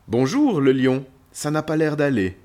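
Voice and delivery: loud, high, friendly hail; calling out. Acted. Child's guide greets a lonely lion, calling out. Hails the lion across savanna.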